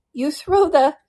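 high, loud storybook shout; excited shout. A narrator's reading. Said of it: "shouted the Griffin with a bound".

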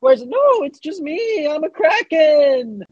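a kraken playfully announcing itself. Acted. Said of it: "It sounds like a kraken".

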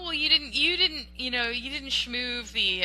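ordinary talk

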